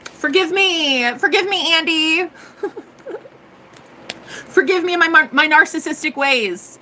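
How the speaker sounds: playful over-the-top contrition; mock pleading. Mock apology to a listener.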